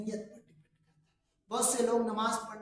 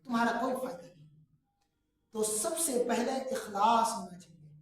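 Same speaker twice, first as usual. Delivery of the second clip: ordinary talk, nothing marked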